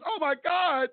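high, gasping mock horror; a lightly voiced shocked bystander; mock shocked onlookers. Mocking the appalled public in high mock horror. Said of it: "everybody was all on the news".

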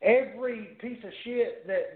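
usual speaking voice

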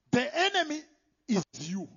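normal talking voice

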